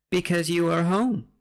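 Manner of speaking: high, eerie put-on voice; creepy stranger. Stranger answering tied-up victims, creepy put-on voice. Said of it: "says it in a really creepy voice".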